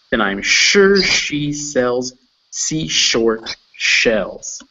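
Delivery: slow recital with forceful "sh" and "s" sounds; pushed sh and s. Shown as a demonstration. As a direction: really push out every "sh" and "s" in "She sells seashells". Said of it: "really kind of push those sh and s sounds out".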